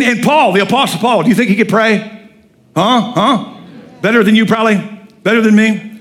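ordinary talk

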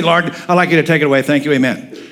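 quick, offhand mock prayer; perfunctory prayer. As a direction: rattle it off casually, dropping away to the amen. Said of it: "So he didn't just say".

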